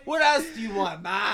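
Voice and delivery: high, shouted question through laughter; Italian guy impression. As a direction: join in the hollering Italian-son caricature. Joins in the Phil impression.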